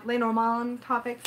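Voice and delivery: Lenormand as "leh-nor-MON", no final D; attempted French pronunciation. Shown as a demonstration. Woman correcting herself on the topics, attempted French pronunciation. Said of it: "never gonna say that right".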